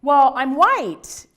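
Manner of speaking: loud, drawn-out mock hesitancy; uncomfortable. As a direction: stretch it out, imitating an unsure student. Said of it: "they would play ignorant or they would get uncomfortable".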